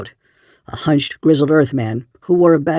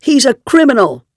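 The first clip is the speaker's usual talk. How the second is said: high, loud, furious accusation; angry accusation; 10 semitones higher, 5 dB louder than usual. A narrator's reading. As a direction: fling the accusation hotly. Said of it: "flush with anger".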